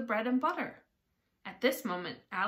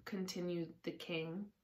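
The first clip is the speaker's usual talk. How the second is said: usual speaking voice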